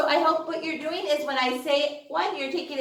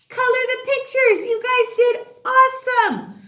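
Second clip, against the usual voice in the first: much higher, louder cheer; excited praise; 11 semitones higher, 5 dB louder than usual. Real, not acted. Teacher praising kids at end of class, high excited.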